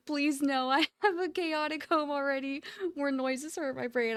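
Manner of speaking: usual speaking voice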